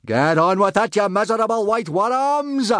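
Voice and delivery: high, loud roar at the dragons; roared order, pitch climbing high. A narrator's reading. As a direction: bellow like a fierce slave-driver. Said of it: "roared Gobber at the saber-toothed dragons".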